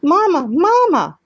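put-on high, slow, loud baby-bird call; drawn-out hatchling squeal; peeping gosling. A narrator's reading. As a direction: cry for mother like a tiny chick. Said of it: "peeped the gosling".

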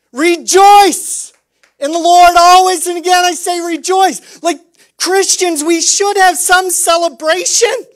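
high, loud joyful proclamation; preaching at full force. Real, not acted. Preacher urging the congregation to celebrate, loud and high. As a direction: lift the church with a loud call. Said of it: "urging joy loudly".

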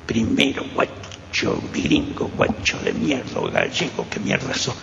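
slow, whispered menace from a policeman; torturer's insults. Retold or imitated. Survivor imitating the comisario's insults, slow whisper. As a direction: hiss the insults slowly like the cop who beat him.

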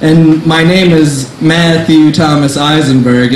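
usual speaking voice

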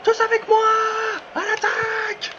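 high, loud rallying cry; rally the team, over the top; getting carried away. Real, not acted. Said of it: "Je m'emballe un peu". (cri de ralliement emballé, aigu et fort)

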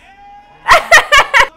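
big loud shrieking laugh; loud burst of laughter. Real, not acted.

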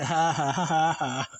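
deliberate ha-ha-ha cackle; spelled-out mocking laughter; gloating laugh. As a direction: laugh in teasing triumph. Gloats that viewers missed it.

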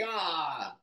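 lightly voiced self-disgust at his reflection; mock dismay. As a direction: groan at himself in the mirror. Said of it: "look in the mirror and be like".

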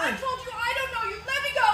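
high, loud, frightened cry; panicked shout. Acted. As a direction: shout him off in terror. Mary struggles free.

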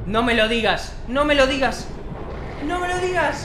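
building disbelief, pitch climbing with each repeat; rising anticipation. Real, not acted. Anticipating the big reveal. (incredulidad creciente, cada vez más aguda)

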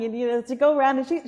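usual speaking voice

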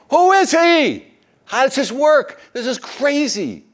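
very high, loud astonished squeal; incredulous crowd. Retold or imitated. Crowd marvelling at Jesus' miracles, high and loud. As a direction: shriek it in total disbelief. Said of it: "they go".

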